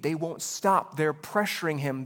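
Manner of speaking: normal talking voice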